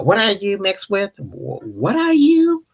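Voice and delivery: high, swooping, drawn-out "you"; mock rude question. Going up to somebody to pry, mock rude. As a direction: exaggerate a nosy stranger's question, rising high. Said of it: "that's so rude".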